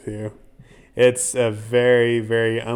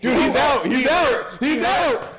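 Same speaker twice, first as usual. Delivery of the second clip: much higher, louder shout; excited shout; 4 dB louder, slower than usual. Real, not acted. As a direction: call the knockout. Announcing knockout on screen.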